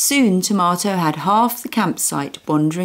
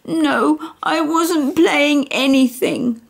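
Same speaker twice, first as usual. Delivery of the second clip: put-on sulky tearful child; 11 semitones higher, slower than usual.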